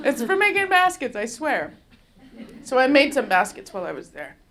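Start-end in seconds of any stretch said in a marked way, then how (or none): 0.1-1.7 high, laughing reassurance; laughing plea. Retold or imitated. Swearing to the dean the knife is for baskets, laughing. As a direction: laugh it off innocently. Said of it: "And I was like".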